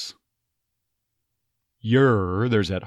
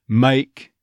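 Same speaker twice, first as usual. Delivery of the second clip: Texas make; 3 semitones higher, 5 dB louder than usual. Shown as a demonstration. An accent coach demoing Make in Texas. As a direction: Make with a neutral first vowel half, like mike. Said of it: "first half of that a diphthong".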